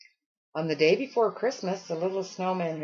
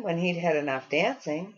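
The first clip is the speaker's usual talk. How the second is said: usual speaking voice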